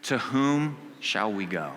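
preacher leading the congregation, slow and solemn; slow solemn recitation. Shown as a demonstration. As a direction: lead the room through it word by word. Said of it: "Can you say that with me?".

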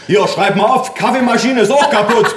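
high, agitated yell; bellowing father. A narrator's reading. Father in the kitchen reporting damage, bellowing. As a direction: yell it from the next room. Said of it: "brüllte mein Vater".

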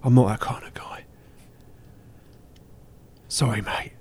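hushed aside; whispering. Acted. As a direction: whisper it low and unvoiced. Blunt brush-off in pub sketch.

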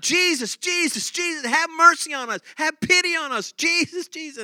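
high, loud pleading cries from far off; lepers calling out. Acted. Lepers crying out to Jesus from a distance, loud and high. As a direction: call out to Jesus, begging for mercy. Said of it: "they started calling out".

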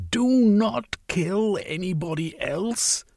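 high, slow plea; crying out. A narrator's reading. Velveen pleads with Sylvia to stop killing, slow and high. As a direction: beg her to stop, slowly. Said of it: "Velveen cried".

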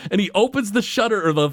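usual speaking voice

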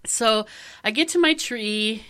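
usual speaking voice

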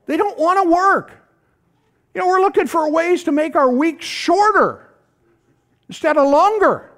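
high, loud preacher's push; preaching at full force. Real, not acted. Pastor pressing the church on hard work, preaching loud and high. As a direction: drive the point home to the congregation.

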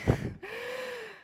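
short high laugh, falling away; light laugh. Real, not acted. Preacher to congregation after apologizing, a short high laugh. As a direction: laugh at your own joke.

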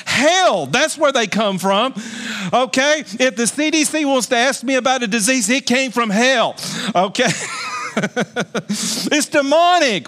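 full-force pulpit delivery; rousing sermon voice; fiery preaching. Acted. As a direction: preach it out, ringing to the whole congregation.

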